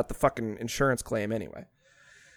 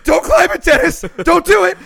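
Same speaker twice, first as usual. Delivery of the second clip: high, louder frantic Charlie pleading; mock panic scream; 12 semitones higher, 7 dB louder than usual. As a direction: shriek the warning in hammed-up panic. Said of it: "he starts screaming".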